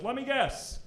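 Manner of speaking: a hint of a knowing waiter; lightly voiced smug guess; mock Indian waiter. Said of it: "and he goes".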